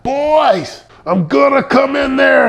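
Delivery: put-on yelling at the boys. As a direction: bellow it through the wall at noisy kids. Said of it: "before I'd be like".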